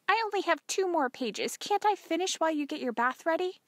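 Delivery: put-on high, pleading girl's voice; protesting daughter.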